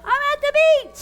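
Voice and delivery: high, loud joyful echo; excited. Pretend. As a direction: shout it out with delight. Echoing the story with joy.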